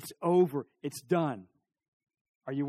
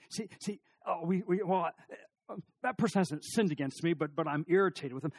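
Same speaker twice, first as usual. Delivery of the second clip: usual speaking voice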